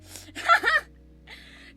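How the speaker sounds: squealing giggle; shrill laugh; high squealing laugh. Real, not acted. Laugh after bell.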